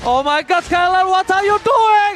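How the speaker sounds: shouted hype call, slow; fighting game announcer. Real, not acted. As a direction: yell it like a big play. Caster reacts to team wipe.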